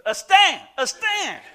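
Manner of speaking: wife shouting her husband's name to wake him; high, loud imitated call "a stand"; calling out loudly. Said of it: "the next thing I heard was".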